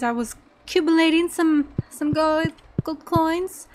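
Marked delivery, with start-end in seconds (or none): none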